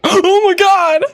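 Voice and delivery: high, loud oh my god; shocked exclaim. Real, not acted. Player shocked by the launch, high and loud. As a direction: blurt it out in shock.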